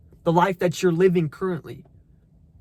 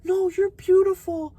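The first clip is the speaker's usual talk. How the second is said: mock sweet reassurance; 11 semitones higher than usual. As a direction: parody the people coddling a fat friend. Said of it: "everyone's like".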